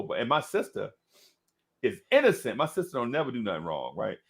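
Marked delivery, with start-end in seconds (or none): none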